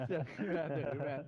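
amused, laughing through the words. Real, not acted. Player teasing teammates about Man's voice, laughing. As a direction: repeat it while breaking into laughter.

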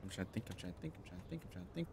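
very soft, repeated mutter; muttering to self. Real, not acted. Player trying to think, muttering to self. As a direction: chant it under the breath.

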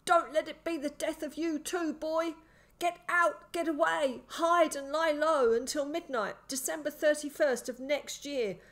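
high, desperate madman's voice; gasping urgent warning. A narrator's reading. Pinned man begs boy to flee, gasping and urgent. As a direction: plead with him, forcing out each phrase. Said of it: "he said between gasps of breath".

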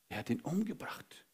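hushed, breathy reveal; dramatic whisper. Acted. Preacher telling church of Cain's murder, whispered.

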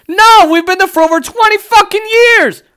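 high, loud angry burst; outraged shout. Real, not acted. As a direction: shout it in exasperation. Ranting at endless war.

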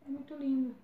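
high-pitched melting at cuteness; adoring coo. Real, not acted. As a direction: coo over something adorably cute. Cooing over a cute product.

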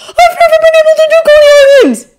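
very high, loud excited squeal; ecstatic boy. Acted. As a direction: burst out as a thrilled kid. Boy cannot contain himself.

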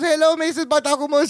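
high, silly sock-puppet voice; funny puppet voice. Retold or imitated. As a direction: put on a high funny voice with a vague accent. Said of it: "In a funny voice".

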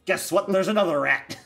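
loud, high hype announcer; wrestling announcer. Acted. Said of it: "Why do you sound like a wrestling announcer?".

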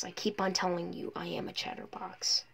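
playful whispered aside; teasing whisper. Acted. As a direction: breathe it to the viewers as a secret.

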